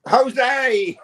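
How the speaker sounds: high, loud cry of the name; shouted punchline. Pretend. Streamer delivering his own joke to viewers, shouted punchline. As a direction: belt out the punchline with glee.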